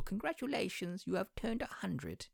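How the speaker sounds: high, soft royal impression; mock Queen. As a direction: imitate the Queen's message, high and quiet. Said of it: "She told old people".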